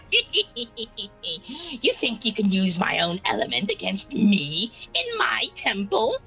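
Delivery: slow, high, laughing witch voice; old hag. Acted. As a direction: gloat slowly like an old hag. GM voices cackling witch Priscilla.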